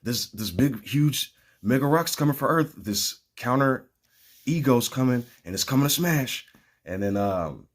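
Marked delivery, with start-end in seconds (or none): 0.0-6.5 frantic warning. Retold or imitated. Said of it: "he's like".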